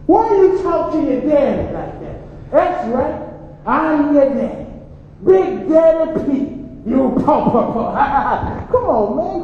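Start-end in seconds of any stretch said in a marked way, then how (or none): none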